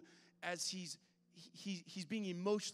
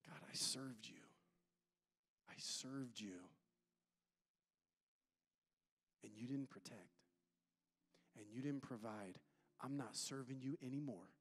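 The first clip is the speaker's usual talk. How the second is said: bitter hushed complaint; 6 semitones lower, 8 dB quieter than usual. Pretend. Preacher voicing bitter prayer.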